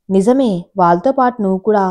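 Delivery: ordinary delivery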